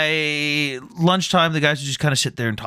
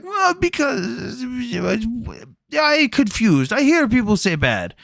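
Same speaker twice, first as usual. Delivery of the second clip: higher, slow mock Ukrainian accent; 9 semitones higher, slower than usual. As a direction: imitate a puzzled Ukrainian soldier asking, higher and slow. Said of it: "They're like".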